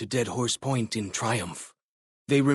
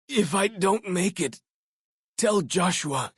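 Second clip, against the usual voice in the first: fading dying words. Acted. Dying companion apologizing to the player, fading. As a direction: let the voice die away.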